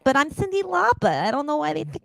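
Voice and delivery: exaggerated New York accent. Singer mistaken for [name], hammed-up New York accent. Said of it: "she's a real New York accent".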